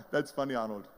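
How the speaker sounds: mock laughing, then an amused brush-off; acted-out belly laugh at a hopeful; imitated scornful laugh. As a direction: laugh it off like a dismissive agent. Agent mockingly laughs off his ambition.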